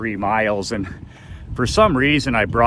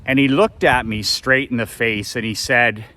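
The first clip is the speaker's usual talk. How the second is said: ordinary talk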